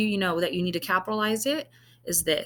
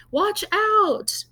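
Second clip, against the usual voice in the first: high, loud cry of alarm; sudden loud warning call; warning shout; 13 semitones higher, 4 dB louder than usual. Acted. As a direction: yell it to stop the ball hitting a head. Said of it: "someone's throwing a football and it's about to hit someone".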